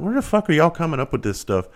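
exasperated dad asking his daughters why they pack so much; exasperated outburst. Retold or imitated. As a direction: pitch jumps way up, incredulous. Exasperated at girls packing.

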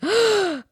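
sharp high shocked gasp; husband's gasp. Retold or imitated. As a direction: imitate a startled gasp, high and loud. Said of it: "just like that. He gasped".

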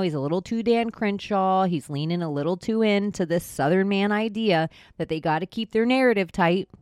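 normal talking voice

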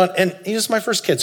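normal talking voice

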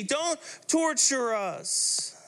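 high, slow, whiny plea from a demon; a scared demon begging in a drawn-out high whine; pleading demon voice. Acted. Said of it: "this demon starts going".

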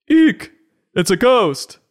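high, loud squeal of pretend terror; mock fright. Mom pretends to see a ghost, mock-frightened. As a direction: shriek it in hammed-up alarm. Story mom acts scared of costume.

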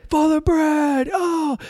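high, drawn-out holler of greeting; retold high-pitched call, stretched out; calling across room. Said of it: "from the other side of the room, he said".

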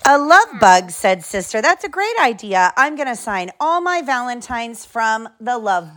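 lively storybook reading; reading to children. Acted. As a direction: read it like bedtime story. Reads picture book aloud.

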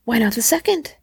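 a touch of feverish urgency; eager, insistent question. A narrator's reading. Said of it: "said the old woman feverishly".

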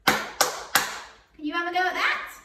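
high, sing-song praise; reading to a toddler. Acted. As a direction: cheer on a small child.

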